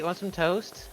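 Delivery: ordinary talk, nothing marked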